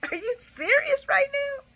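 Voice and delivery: high, disbelieving squeal; high incredulous squeal. Retold or imitated. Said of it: "did you see Esme's face".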